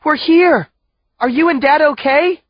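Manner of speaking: high, loud anxious call. A narrator's reading. Daughter calling to her parents, anxious. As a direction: shout into the dark to find them. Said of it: "Wisty calls into the dark".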